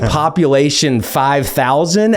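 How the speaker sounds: high, teasing disbelief; mock surprise. Lawyer teasing opposing counsel about his small town, mock surprise. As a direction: needle him about his tiny hometown.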